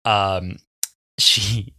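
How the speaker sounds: ordinary delivery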